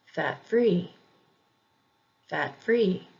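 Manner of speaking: fat-free with FREE stressed; stress on second part. Shown as a demonstration. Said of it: "emphasis on the second syllable".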